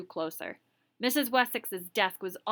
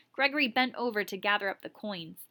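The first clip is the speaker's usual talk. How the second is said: plain reading voice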